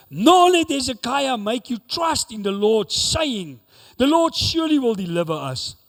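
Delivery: ringing, emphatic proclamation of an enemy's threat; preacher voicing a herald's message; declaimed scripture. A narrator's reading. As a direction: read it out forcefully, stretching the openings of phrases.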